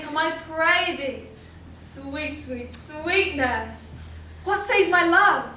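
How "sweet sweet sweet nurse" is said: soft, low, drawn-out wheedling; coaxing. Acted. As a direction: sweet-talk her slowly into telling. Wheedles the nurse for news.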